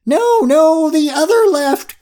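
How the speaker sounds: very high, loud frantic yell; yelled correction. A narrator's reading. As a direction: yell the correction in exasperated panic. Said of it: "Curie yelled".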